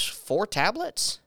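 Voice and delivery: slow, rising, incredulous Four tablets?; surprised question. Retold or imitated. Nurse hearing a shocking dose, surprised. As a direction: stretch it out in disbelief.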